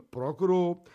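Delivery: usual speaking voice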